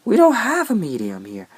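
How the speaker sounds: high, loud, incredulous cashier; mock disbelief. Barista mocks the customer for ordering a medium, incredulous. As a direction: squeal it like a baffled barista. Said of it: "She was like".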